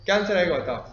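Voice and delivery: ordinary delivery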